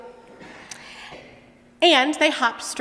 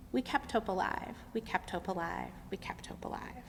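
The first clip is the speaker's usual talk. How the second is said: softer each time, ending in a whisper; fading croak; 13 dB quieter than usual. Acted. Frogs hopping away, chant fading to a whisper. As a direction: let it drift off into the distance. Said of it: "you can hear them croaking".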